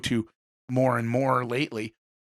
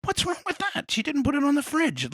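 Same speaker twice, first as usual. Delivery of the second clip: put-on high whiny child's protest, higher pitch; upset little kid; 13 semitones higher than usual. Child complaining to mom, high and whiny. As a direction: whine it like a hurt little girl. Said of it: "I'm like".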